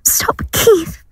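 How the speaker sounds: whispered plea, high and scared; frightened plea. Acted. Gail begs violent husband Keith to stop, scared whisper. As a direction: beg him breathlessly to stop.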